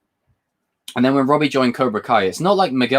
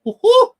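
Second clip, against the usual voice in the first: very high, loud whoop; excited whoop; 8 dB louder than usual. Real, not acted. Fan awaiting Fast Nine, excited whoop. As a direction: whoop for joy. Excited about upcoming film releases.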